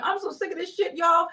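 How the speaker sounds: usual speaking voice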